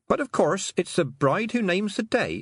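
sweetly cheerful, springing the trap; cheerfully. Acted. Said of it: "said Tiffany cheerfully".

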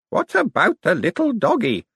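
high, lightly voiced elderly aunt; a frail old lady, lightly done. A narrator's reading. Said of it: "said an elderly voice".

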